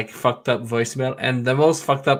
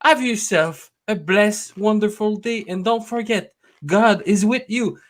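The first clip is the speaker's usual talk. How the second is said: higher, sing-song churchy message voice; imitating religious voicemail; 5 semitones higher than usual. Caller mocking an old woman's religious voicemail, sing-song. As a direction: bless the caller in a lilting tone. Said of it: "It's like".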